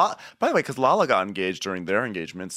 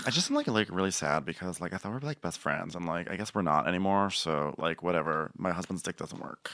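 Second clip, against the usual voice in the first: much softer, sad mumbled voice; Katie impression; 5 semitones lower, 10 dB quieter than usual. Friend impersonates Katie, soft and mopey. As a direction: mope it quietly like a hurt friend.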